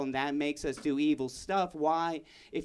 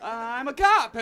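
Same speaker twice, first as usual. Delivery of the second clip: loud reveal; 8 semitones higher, 9 dB louder than usual. Acted. Kid reveals he's a cop.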